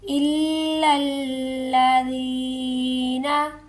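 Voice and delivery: इल्लल्लज़ीना with both lams pressed and held; doubled lam held. Shown as a demonstration. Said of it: "one second रुक कर". (इल्लल्लज़ीना में दोनों लाम पर ज़ोर)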